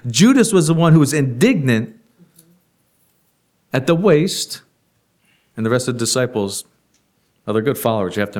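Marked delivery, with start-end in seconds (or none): none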